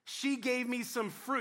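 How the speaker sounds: slow, pointed blame-shifting; sulky husband passing the buck; blaming Adam. Acted. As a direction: drag it out, pinning it on her. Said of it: "He wouldn't even use her name".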